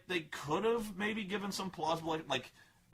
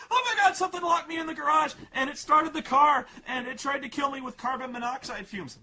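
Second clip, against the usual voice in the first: high, loud, fast panicked shout; mock a terrified girl blurting it all out; screaming in fear; 9 semitones higher, 7 dB louder than usual. Girl running to her dad, high panicked mock screaming. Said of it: "comes running and screaming to her dad".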